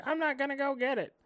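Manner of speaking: very high, whiny mock refusal; imitated arguing woman. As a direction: pitch it way up as the arguing girlfriend. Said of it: "they were arguing over".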